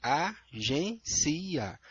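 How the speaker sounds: Agência split very slowly, a-gên-ci-a; Agência separada em sílabas; dictionary syllables. Shown as a demonstration. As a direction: break Agência into four syllables. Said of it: "Como que eles separam?".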